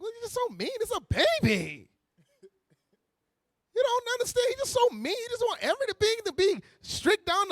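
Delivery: high, soft whiny complaint; mock the pouting mother. Mother whining about strict father.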